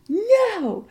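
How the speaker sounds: high, drawn-out refusal; defiant kid. Retold or imitated. Child refusing to sit, retold.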